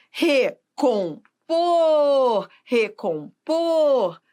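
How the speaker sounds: recompor syllable by syllable, POR drawn out each time; stress on POR. Shown as a demonstration. Teacher pointing out the tonic syllable, slow recompor with long POR. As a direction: slowly split recompor and hold the final POR. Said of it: "o por é a sílaba tônica".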